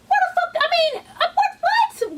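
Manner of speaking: cracking up in shock; real stunned giggle; laughing disbelief. Real, not acted. Reacting to the song choice.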